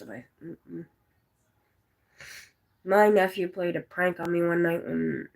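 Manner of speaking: normal talking voice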